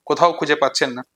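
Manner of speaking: normal talking voice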